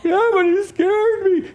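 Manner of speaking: put-on high, whiny kid excuse through laughter; toddler voice. Toddler excuses himself to adult, high and whiny. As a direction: whine like a guilty toddler.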